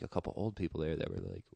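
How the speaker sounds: ordinary delivery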